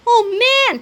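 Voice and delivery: high, loud amazement; excited cry. A narrator's reading. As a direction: cry out like a thrilled kid. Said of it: "cried Cheeseball".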